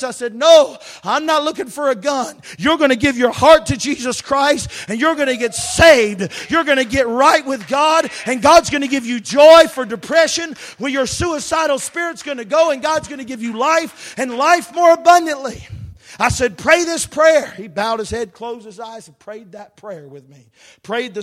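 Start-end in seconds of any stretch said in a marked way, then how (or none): 0.3-15.7 loud, higher, insistent preaching at him. Retold or imitated. Preacher urging a suicidal young man, loud insistent preaching. 16.6-17.5 commanding. Retold or imitated. Commands man to pray.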